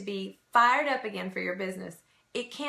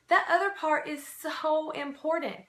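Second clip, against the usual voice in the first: ordinary delivery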